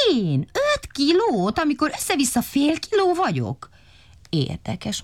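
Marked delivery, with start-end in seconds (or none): 0.1-3.7 tiny siren crow voice. A narrator's reading. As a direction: squeak it out like a small siren. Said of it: "Szeréna torkának adta szirénázás".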